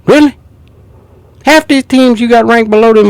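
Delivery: high, loud disbelieving yelp; can't believe the ranking, really; incredulous. Real, not acted. To the analyst about Miami's ranking, a loud incredulous yelp.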